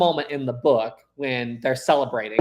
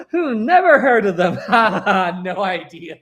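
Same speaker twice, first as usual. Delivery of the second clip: laughing; 6 semitones higher, 7 dB louder than usual. Real, not acted. Mock ignorance about the Beatles.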